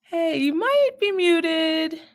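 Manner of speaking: ordinary talk, nothing marked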